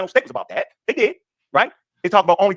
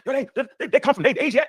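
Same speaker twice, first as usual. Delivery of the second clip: mocking imitation; 8 semitones higher than usual. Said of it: "'Cause I heard people say".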